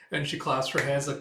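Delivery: ordinary delivery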